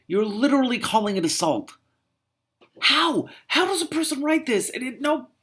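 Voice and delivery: high, loud, exasperated disbelief; shrill with disbelief at the prose; incredulous rant. Real, not acted. Reader ranting at the book in high disbelief.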